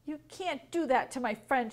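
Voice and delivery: ordinary delivery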